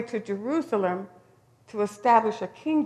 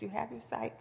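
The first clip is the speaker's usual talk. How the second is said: tender pronouncement; 2 semitones lower, 14 dB quieter than usual. Retold or imitated. Jesus speaks healing words.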